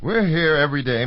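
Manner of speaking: usual speaking voice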